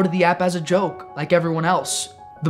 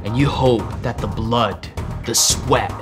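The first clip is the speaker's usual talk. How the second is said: dramatic voiceover; 4 semitones lower than usual. Acted. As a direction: announce it like an epic movie trailer.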